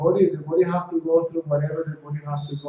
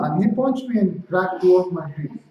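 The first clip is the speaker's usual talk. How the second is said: ordinary talk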